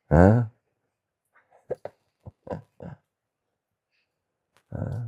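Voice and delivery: usual speaking voice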